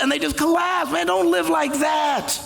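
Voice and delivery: usual speaking voice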